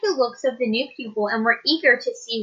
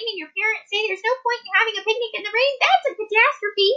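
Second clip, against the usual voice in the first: put-on tiny, much higher little-girl voice; small high voice; 10 semitones higher than usual. Little Jenny answering the teacher in a tiny high voice. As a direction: pipe it up small and high, rushing near the end. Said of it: "In a very small, high voice".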